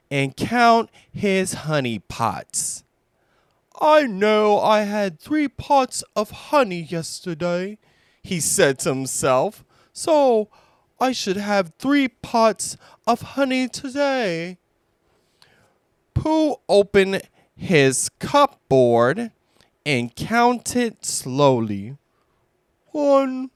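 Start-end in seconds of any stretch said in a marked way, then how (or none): 3.8-7.8 higher, gentle bear voice; Pooh voice. Acted. 9.9-14.6 higher, gentle bear voice; Pooh voice. Acted. 22.9-23.5 higher, gentle bear voice; Pooh voice. Acted. Pooh counting.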